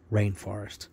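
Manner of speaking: usual speaking voice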